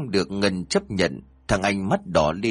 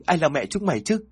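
higher, panicked denial; frightened denial; 7 semitones higher than usual. A narrator's reading. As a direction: deny them in terror. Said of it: "Sợ quá". (hoảng sợ chối bỏ, giọng cao)

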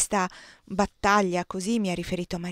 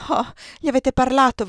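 higher, louder burst of eagerness; eager exclamation; 5 semitones higher, 7 dB louder than usual. A narrator's reading. Woman to colonel about Giambattista, eager. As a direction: seize on the news with an "Oh".

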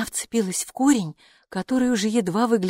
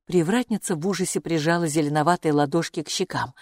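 ordinary delivery

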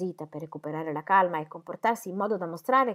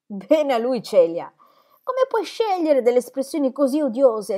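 louder, sharp indignation on bene a lui; vehement outcry; 7 semitones higher, 9 dB louder than usual. A narrator's reading. Dorothea angrily rebuking Celia's words, louder. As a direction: flare up in offended anger, louder. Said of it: "esclamò Dorotea con veemenza".

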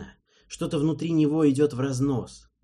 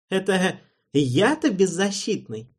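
offended scoff; 8 semitones higher, 5 dB louder than usual. A narrator's reading. Alvin offended.

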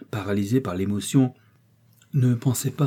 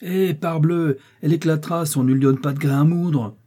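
raised, louder cross retort; ill-tempered; 6 semitones higher, 5 dB louder than usual. A narrator's reading. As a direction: snap back in bad humour. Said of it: "dit François avec humeur".

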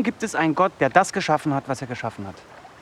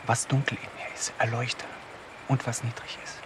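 soft, low whispered repeat; the prayer again, even more hushed; 4 semitones lower, 8 dB quieter than usual. Acted. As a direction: murmur the same plea again under the breath.

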